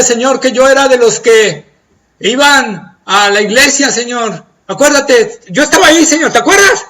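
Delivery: high, loud begging reminders; desperate pleading. Acted. Believer begging the Lord to remember him, high and loud. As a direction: beg him to remember you.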